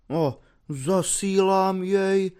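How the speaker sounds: slow, quavering and full of mock tragedy; trembling tragic voice. Švejk repeating the dictation to his officer, slow and trembling tragically. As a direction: let the words shake with tragic grief. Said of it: "řekl třesoucím se hlasem plným tragiky".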